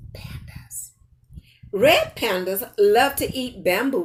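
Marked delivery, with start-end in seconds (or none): 0.1-0.9 a hushed, secret whisper. Acted. Grandma teasing the kids about the next animal, in a whisper. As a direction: whisper it like a surprise reveal.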